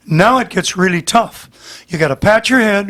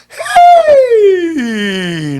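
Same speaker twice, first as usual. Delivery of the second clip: high, loud open "Hey!"; demo shout; 10 semitones higher, 5 dB louder than usual. Shown as a demonstration. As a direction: belt out a sudden "Hey!" as a demo. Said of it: "We go like this".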